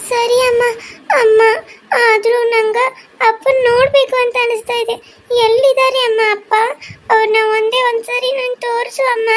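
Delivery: put-on high child voice, begging through tears; tearful pleading daughter. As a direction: plead with mother like a sad little girl. (ಅಳುತ್ತಾ ಬೇಡುವ ಪುಟ್ಟ ಮಗಳ ಧ್ವನಿ)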